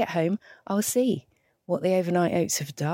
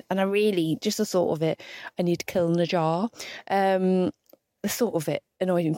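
normal talking voice

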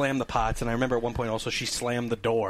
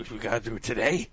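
man in bed; 4 semitones lower than usual. Retold or imitated. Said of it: "picture him just in bed".

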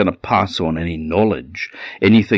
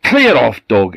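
loud, much higher bark of a command; gruff shout; 4 semitones higher, 6 dB louder than usual. Acted. The fisherman shouts at his joyful dog, high angry yell.

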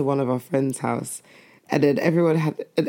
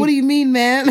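put-on higher, louder laughing kid voice; kid's London slang; 8 semitones higher, 4 dB louder than usual. Mum repeats her child's line with a laugh in a London kid voice. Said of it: "one of them said".

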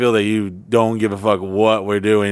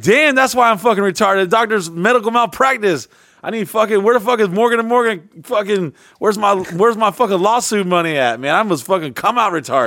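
mock outraged self; 7 semitones higher than usual. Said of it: "I was like".

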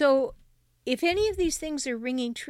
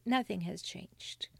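dropped to a whisper; 4 semitones lower, 7 dB quieter than usual. Real, not acted. Therapist to abused listener, hushed whispered aside. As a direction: whisper "nothing has changed" softly, under the breath. Counselor revealing the sad truth.

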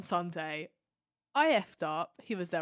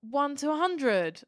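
high, loud, drawn-out call; calling out tickets; 7 semitones higher, 7 dB louder, slower than usual. Retold or imitated. Steward calling ticket numbers to the crowd, imitated loud call-out. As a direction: mimic a steward bellowing out numbers slowly. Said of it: "then they start going".

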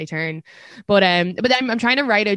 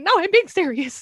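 high-pitched, insistent protest; musical anger; 10 semitones higher, 4 dB louder than usual. Acted. Comic protesting after people laugh at her anger, high-pitched indignation. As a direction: insist on being taken seriously, pitched way up. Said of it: "hard to show people that I'm angry".

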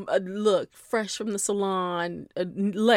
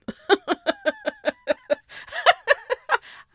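a squealing laugh at her own pun, much higher and louder; high burst of laughter; 5 dB louder than usual. Real, not acted. Host laughing at her own club-cub pun, high squealing laugh. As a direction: crack up at your own joke. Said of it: "I tickle myself".

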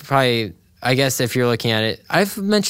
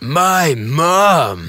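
drawn-out, swooping catchphrase voice; exaggerated impression, stretched wide; Muscle Man; 3 semitones higher, 4 dB louder than usual. Co-host asked to do the iconic line, exaggerated impression. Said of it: "say it in his voice".

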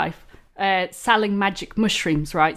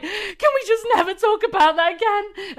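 laughing plea; 10 semitones higher than usual. Retold or imitated. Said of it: "And she was like".

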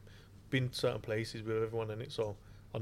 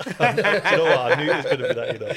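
loud, high laughing through words; laughing at Ollie's role; 4 semitones higher, 13 dB louder than usual. Real, not acted. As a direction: crack up while talking.

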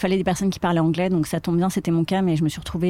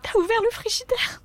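much higher, over-the-top gushing praise; hammed-up adoring girlfriend praising her man; mock adoration. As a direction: marvel at a trivial feat as if heroic. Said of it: "montrer à son homme qu'il est le plus fort".

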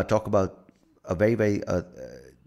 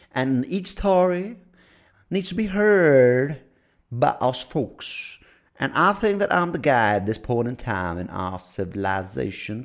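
wannabe Martin Luther King; 7 semitones higher, 6 dB louder than usual. Acted. Podcast host declaring everybody has a story, mock grand speech. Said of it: "my wannabe Martin Luther King speech".

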